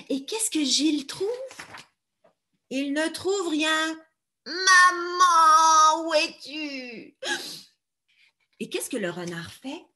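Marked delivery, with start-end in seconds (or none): 4.5-7.2 high, slow plaintive call; baby crow crying. Acted. Little Gilles searching for his mother, high plaintive cry. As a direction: call out for mama, lost.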